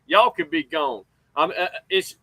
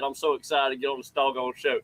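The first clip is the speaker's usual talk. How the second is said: usual speaking voice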